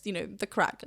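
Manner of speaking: ordinary delivery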